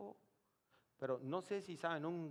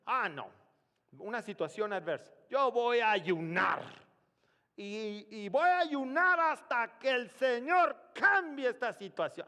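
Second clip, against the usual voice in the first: much higher and louder mock boast of fasting; 8 semitones higher, 12 dB louder than usual. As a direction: parody a self-sure believer. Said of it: "cristianos bien listos que dicen". (burla de un cristiano creído, alta y fuerte)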